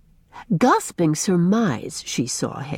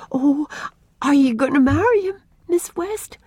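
high, bright, hopeful question; eager child's question, much higher; 9 semitones higher than usual. A narrator's reading. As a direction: burst out hopefully. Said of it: "asked Una eagerly".

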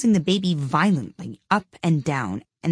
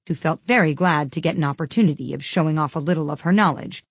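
usual speaking voice

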